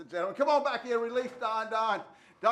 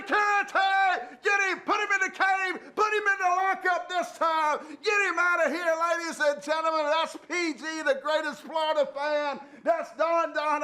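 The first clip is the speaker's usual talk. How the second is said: mock shouted orders; 7 semitones higher than usual. Host ordering security to lock up the caller, mock shouting. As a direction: yell to throw him in the lockup.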